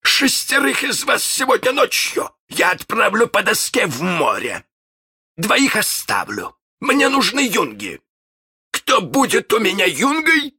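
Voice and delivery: high-pitched pirate captain laying down orders; commanding villain voice, rising to a shout; resolute. Acted. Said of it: "наконец, решительно".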